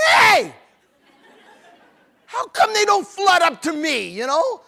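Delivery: loud, high mock outburst of envy; mock jealous shout. Preacher to the crowd, a loud mock jealous shout. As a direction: shout it like a jealous kid left out.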